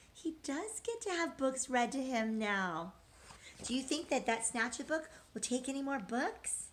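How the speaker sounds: ordinary delivery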